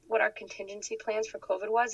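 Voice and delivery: ordinary talk, nothing marked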